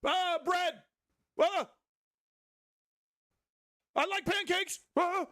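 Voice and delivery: high, loud, silly shouting voice; mocking chaotic Twitch chat, squealed high and loud; imitating chat shouting. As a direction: shriek random nonsense like a chaotic chat. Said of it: "They just shout things".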